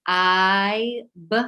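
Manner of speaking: I said as a long vowel, then a bare b sound; sound isolation. Shown as a demonstration. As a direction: split I and b into separate sounds. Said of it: "what's the next sound in tr".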